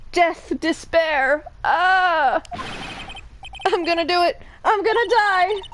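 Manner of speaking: high, hammed-up wail of doom; melodramatic despair. Client despairs to his lawyer, high hammed-up wail. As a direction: overact a client who wants to die.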